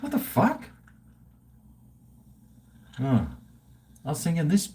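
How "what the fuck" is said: squeaky jump way up in disbelief; shocked high exclamation. Real, not acted. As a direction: blurt it out, stunned and high. Shocked at buying twenty houses.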